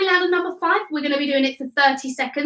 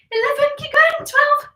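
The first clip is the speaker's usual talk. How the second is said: bright coach's call, much higher; high excited cheer; 11 semitones higher than usual. Real, not acted. Fitness coach urging children mid-sprint, high excited call.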